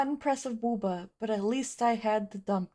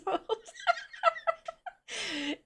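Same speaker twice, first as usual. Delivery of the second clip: cartoon laugh; 13 semitones higher, 3 dB quieter than usual. Real, not acted. Laughing at not knowing size.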